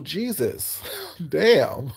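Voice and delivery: usual speaking voice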